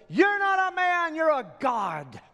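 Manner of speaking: high, loud adoring yell; one voice crying out in worship; crowd yell. Acted. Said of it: "one of them in the crowd yells".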